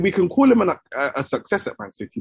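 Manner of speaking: ordinary talk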